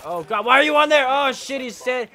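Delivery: shrill, loud startled outburst; startled yelling. Real, not acted. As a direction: yelp in sudden alarm. Startled by enemy on roof.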